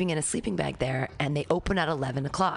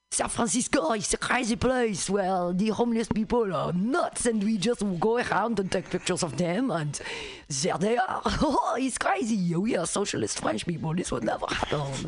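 lightly pitched-up mock French accent; gawking French tourist, a touch of accent; 3 semitones higher than usual. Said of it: "they're like".

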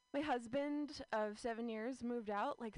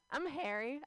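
ordinary talk, nothing marked

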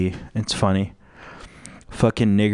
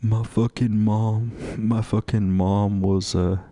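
mock crying athlete. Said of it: "They're like".

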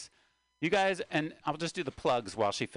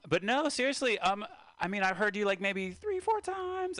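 excited; 11 semitones higher, 3 dB louder than usual. Real, not acted. Excited high run.

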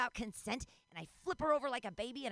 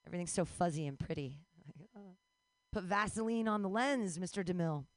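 ordinary talk

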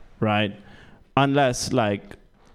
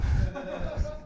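high breathy giggle; 7 dB quieter than usual. Real, not acted. Laughs at own joke.

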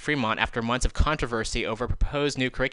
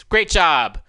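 loud, higher mock congratulation; sarcastic praise; 6 semitones higher, 7 dB louder than usual. Real, not acted. Mocking the school board's decision, sarcastic. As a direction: applaud them with pure sarcasm.

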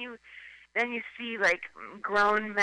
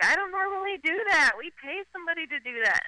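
mock rich wife; 9 semitones higher than usual. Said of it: "she's like".

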